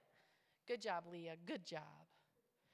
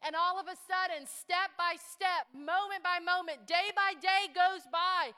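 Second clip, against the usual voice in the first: loud, high climactic preaching; preaching at full force; 8 semitones higher, 13 dB louder than usual. Acted. Pastor to the church, loud and soaring preaching. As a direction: build the congregation to a roaring peak.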